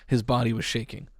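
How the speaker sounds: ordinary delivery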